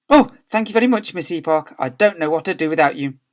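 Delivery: loud, high flustered thanks; startled awake. A narrator's reading. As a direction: wake with a jolt and gush thanks. Miss Stint wakes with a start.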